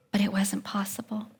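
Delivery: hushed, voiceless whisper. Real, not acted. Speaker to the audience, recalling the grim news, whispered. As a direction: let the words fall to a breath.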